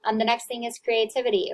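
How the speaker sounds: ordinary delivery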